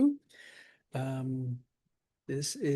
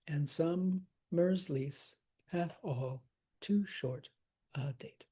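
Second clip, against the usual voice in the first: slow, foot by foot, "And summer's lease hath all too short a date"; "too" drawn out and rising, "short a date" dropping softer; separated feet; 5 dB quieter, slower than usual. Shown as a demonstration. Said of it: "separate the feet".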